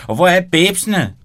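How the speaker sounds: high, loud exclamation; exclaiming. Acted. Benny demanding where the girls are, exclaiming loudly. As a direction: burst out the question. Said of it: "udbrød Benny".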